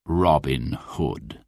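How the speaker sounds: low, soft, slow naming; quiet menace. A narrator's reading. Sheriff quietly greets his captured enemy, low. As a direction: savour his name quietly. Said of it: "said the sheriff quietly".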